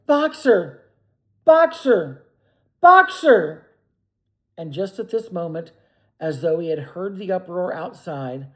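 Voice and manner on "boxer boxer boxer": high, loud, growing louder; desperate calling. A narrator's reading. Clover galloping after the van, calling desperately. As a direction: cry his name ever louder.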